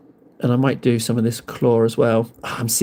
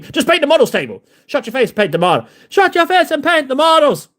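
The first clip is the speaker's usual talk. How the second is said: high, mock-scolding Irish accent; comic Irish telling-off; put-on Irish accent; 11 semitones higher than usual. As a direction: scold in a hammed-up Irish lilt, rising higher. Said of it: "I don't know why I'm getting Irish".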